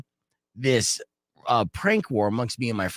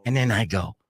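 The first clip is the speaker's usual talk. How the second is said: usual speaking voice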